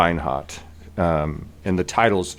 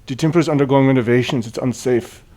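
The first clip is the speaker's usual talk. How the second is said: higher, louder rote brush-off; officious, in-character dismissal; oblivious priest; 4 semitones higher, 4 dB louder, faster than usual. Acted. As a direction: repeat a set speech, ignoring the threat. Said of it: "And he says".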